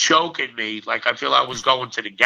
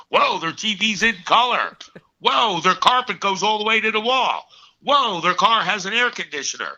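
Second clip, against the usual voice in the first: put-on higher, wide-eyed childhood wonder; gasping at every luxury like a kid; amazed kid; 7 semitones higher than usual. Kid at a friend's house, amazed by the colour TV.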